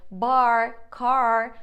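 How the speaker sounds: "bar, car" with heavy American R; loud, slow "bar, car" with a really strong R. Shown as a demonstration. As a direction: draw out "bar, car", leaning on the R. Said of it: "your R is really strong".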